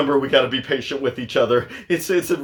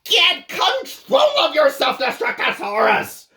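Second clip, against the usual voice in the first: scolding shout; 11 semitones higher than usual. Acted. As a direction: yell at him to get a grip. Parent yelling at rampaging monster.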